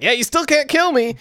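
high-pitched, cocky taunt; taunting demon. Retold or imitated. Trapped demon reminding hunters he is unkillable, taunting. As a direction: lift it high and needling.

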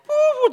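a high, loud imitated siren wail; mock alarm blare.

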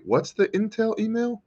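high squeaky questioning viewer. Pretend. Imagined viewer asks, high far.

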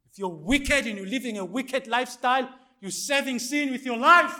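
loud, high-pitched pulpit warning; forceful preaching. Real, not acted. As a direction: hammer the warning home to the congregation.

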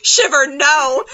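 exasperated yell, acted; mock alarmed shout. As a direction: shout after a misbehaving pet, hammed up. Acting characters shouting at pet.

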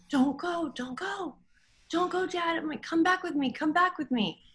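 high pleading, mildly tearful; begging a vanishing father to stay; retold wailing. Grieving daughter begs her dad in the dream, high and pleading. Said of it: "I started wailing, bawling".

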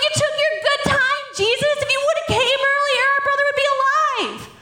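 high, hammed-up angry accusation; mad. Sisters confronting Jesus over their dead brother, mad. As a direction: scold him in high-pitched outrage. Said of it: "They were mad!".

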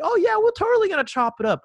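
very high-pitched mock eagerness; squeaky, over-keen imitation; mocking clueless wannabe. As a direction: parody someone clueless trying to sound cool.

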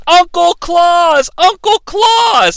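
very high, drawn-out kiddie call; hammed-up kids calling out; cheering children. Kids greet their uncle, high drawn-out cheer. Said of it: "But the kids are like".

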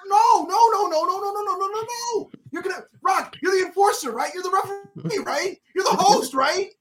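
high, panicky string of protests; desperate denial. Retold or imitated. Fan pleading with The Rock on screen, desperate denial. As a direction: plead as if it cannot be true.

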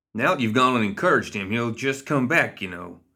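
rough outlaw voice. Acted. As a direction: needle him like a gang member. Mad Dog chiding Parker.

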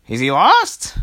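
put-on high, loud, slow old lady question. Old lady fussing at the princesses, high and slow. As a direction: stretch it out, fussy and concerned. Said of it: "the old lady said".

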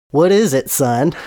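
Southern preacher voice; southern accent. Acted. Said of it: "he has a southern accent".

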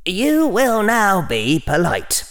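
high-pitched scolding inner voice; Hufflepuff. A narrator's reading. Hufflepuff part telling Harry to be polite, high scolding. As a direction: lay down the law, high and insistent. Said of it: "said the voice of Hufflepuff inside his head".

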